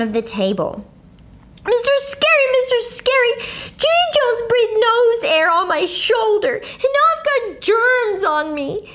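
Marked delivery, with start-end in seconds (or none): 1.7-8.9 yelling. A narrator's reading. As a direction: yell to tell on her. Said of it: "she yelled".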